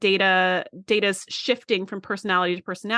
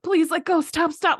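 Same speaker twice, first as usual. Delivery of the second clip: higher, pleading in pain; mock pained plea; 8 semitones higher than usual. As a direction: beg him to let go, hurting. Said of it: "he's in so much pain".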